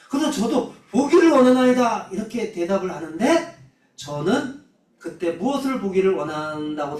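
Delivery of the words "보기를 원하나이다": re-enacted loud, high plea; calling out. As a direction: echo the cry, raised in pitch and volume. Repeats the plea as own answer.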